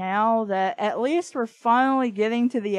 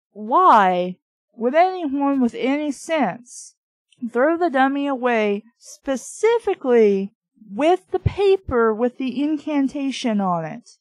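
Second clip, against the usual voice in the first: exasperated rant; 6 dB louder than usual. Real, not acted. Reader complaining to listeners about the plot, louder. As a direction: really vent at the silly choice.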